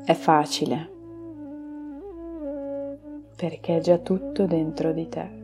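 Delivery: slow, soft meditation voice; guided meditation. Acted. Leads a relaxation meditation.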